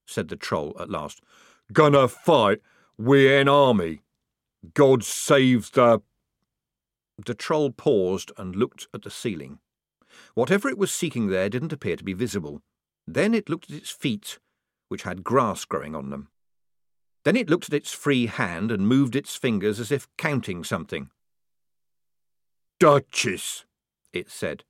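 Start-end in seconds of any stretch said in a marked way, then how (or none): 1.7-6.0 big slow troll, loud and heavy. Acted. Troll speaks slowly, heavily. 22.8-23.6 troll voice. Acted. Troll speaks one word.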